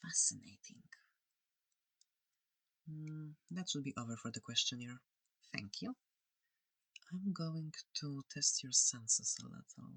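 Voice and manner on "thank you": ordinary talk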